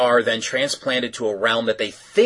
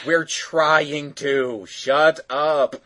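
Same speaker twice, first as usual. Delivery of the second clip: very slow, drawn-out comeback; lightly voiced slow retort; 4 semitones higher, much slower than usual. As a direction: stretch it out in mock annoyance. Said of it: "they're like".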